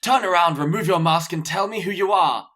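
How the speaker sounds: dramatic film-villain order; movie villain command. Retold or imitated. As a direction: bark it like an emperor. Quoting Gladiator scene dramatically.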